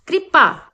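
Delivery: कृपा said slowly with a rolled कृ cluster; demo pronunciation. Shown as a demonstration. Teacher showing children how to say कृपा. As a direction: spell out कृपा clearly as kri-pa. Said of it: "तो ये इस तरह से बोला जाता है".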